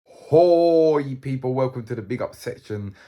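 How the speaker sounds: loud high holler easing into a welcome; booming opening shout to the audience; hailing the viewers. Real, not acted. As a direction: call out big, then settle down. Hailing viewers to open segment.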